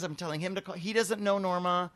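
ordinary delivery

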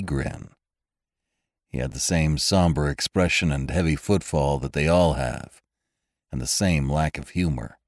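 low, wry hard-boiled narration; dry noir storyteller; noir detective. A narrator's reading. Noir first-person story narration.

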